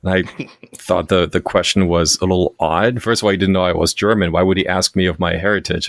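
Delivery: usual speaking voice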